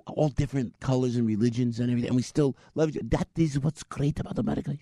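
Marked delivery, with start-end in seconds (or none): 3.1-4.8 badly faked Pakistani accent. Driver praising America to passenger, in a mock Pakistani accent.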